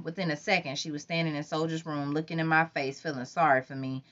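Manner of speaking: ordinary delivery, nothing marked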